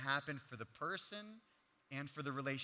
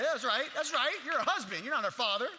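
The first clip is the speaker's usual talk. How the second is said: much higher, louder call-out; loud agreement; 9 semitones higher, 10 dB louder than usual. Real, not acted. Congregation member cheering the point, loud and high. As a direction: shout agreement from the crowd. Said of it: "like someone else knows that".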